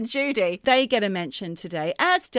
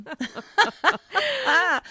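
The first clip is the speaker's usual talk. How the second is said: high laugh; 9 semitones higher than usual. Real, not acted. Laughs at own joke.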